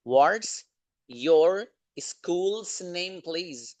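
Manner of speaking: very slow, with pauses between chunks; word-by-word repeat. Shown as a demonstration. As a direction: break the sentence up for learners. Repeats it broken up for learners.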